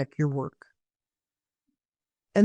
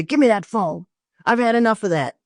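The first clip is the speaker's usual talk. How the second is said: mock angry snap; 4 semitones higher, 4 dB louder than usual. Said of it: "then you're both in a bad mood".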